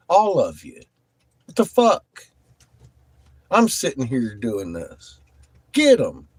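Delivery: real exasperated outburst, punching the curses; angry command. Real, not acted. Streamer ordering moderators onto spammers, angry. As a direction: order them into action, fed up.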